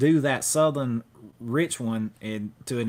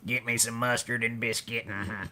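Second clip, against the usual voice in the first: softer Southern character voice, trailing into a grunt; Sling Blade impression; 5 dB quieter than usual. Co-host answering with a film quote, Sling Blade impression. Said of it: "Sling Blade?".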